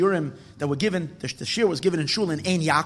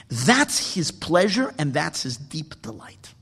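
ordinary delivery

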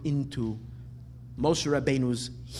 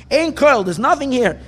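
loud whiny complaint; 8 semitones higher, 9 dB louder than usual. Retold or imitated. Kids' complaint acted out.

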